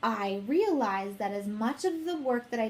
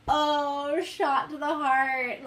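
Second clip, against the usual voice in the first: higher and louder, slowed like a sudden hurt; stung exclamation; 4 semitones higher, 5 dB louder, much slower than usual. Retold or imitated. Retelling her reaction to the mentor's remark, mock stung. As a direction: clutch your heart at the words.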